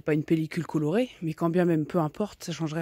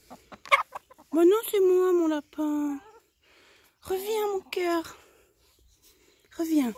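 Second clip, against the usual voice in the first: cooing pet talk; 10 semitones higher, much slower than usual. Real, not acted. Calling back her frightened hen in a high, slow coo.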